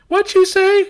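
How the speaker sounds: shrill high indignant squeak; offended girl. Pretend. Imagined girl squealing back at a creepy pickup line, high and loud.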